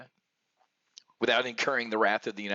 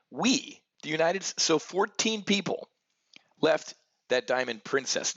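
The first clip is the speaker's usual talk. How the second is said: slow, deliberate repeat; 6 semitones higher than usual. Shown as a demonstration. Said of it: "let me say that again slowly".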